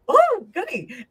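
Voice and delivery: high, slow, delighted; mock-giddy psychic glee; gleeful. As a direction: savour it like a treat. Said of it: "the psychic in me goes".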